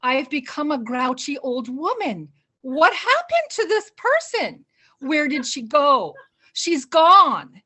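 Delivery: mock-tragic self-pity building to a wail; despairing to herself. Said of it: "and I said".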